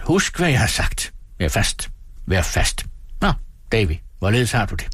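a hint of a cold, firm stepfather; a stern man steadying his wife; stern Murdstone. A narrator's reading. Stepfather Murdstone admonishes firmly. (stedfaren formaner sin kone koldt og bestemt)

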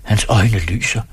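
ordinary delivery, nothing marked

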